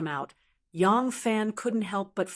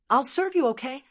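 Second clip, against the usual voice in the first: seductive; 5 semitones higher, 3 dB louder than usual. Acted. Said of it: "saying in a seductive voice".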